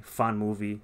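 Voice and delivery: usual speaking voice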